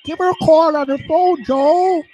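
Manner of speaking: falsetto [name] impression. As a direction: squeak it like a bad celebrity imitation. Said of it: "this nitwit says".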